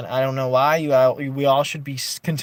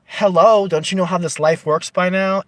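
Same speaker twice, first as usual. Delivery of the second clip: sarcastic "hello", much louder; mock hello; 4 semitones higher, 8 dB louder than usual. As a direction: talk down to them like they should know. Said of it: "a double entendre of".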